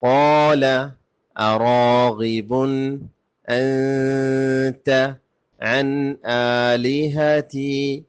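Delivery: slow, melodic tajweed chant with long held vowels; Quran recitation. Shown as a demonstration. Teacher recites the verses for learners, measured Quranic chant. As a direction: chant each phrase in sustained tones with full pauses.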